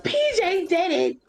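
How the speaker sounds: put-on high whiny kid voice; kid blaming. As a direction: squeal it like a tattling kid. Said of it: "they're like".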